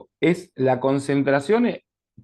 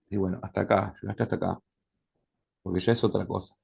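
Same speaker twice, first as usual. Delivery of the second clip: quiet firm limit; 4 semitones lower, 7 dB quieter, faster than usual. Acted. Therapist sets limit to patient.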